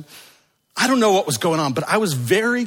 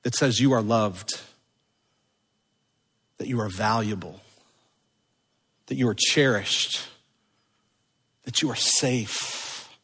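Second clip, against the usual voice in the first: tender voice; 2 semitones lower than usual. Acted. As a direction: lay each promise down gently. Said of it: "he says, is the tender voice".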